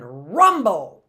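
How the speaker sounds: "rumble" with a long, loud opening R; R sound. Shown as a demonstration. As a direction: lean hard into the R of "rumble". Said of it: "R makes a R sound".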